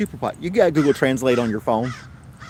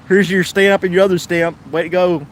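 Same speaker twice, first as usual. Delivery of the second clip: louder, higher mock congratulation; mock taunt; 7 semitones higher, 6 dB louder than usual. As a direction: toss it back at them sarcastically. Imagined taunt to junk mail senders.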